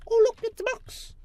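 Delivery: very high, loud cutesy voice; squeaky cartoon voice. Mocking the villager with a box.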